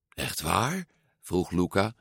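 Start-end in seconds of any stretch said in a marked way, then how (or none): 0.1-0.9 hushed, rising disbelief; astonished. A narrator's reading. Luca hears he may go to school, asking in hushed amazement. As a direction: ask softly, barely believing it.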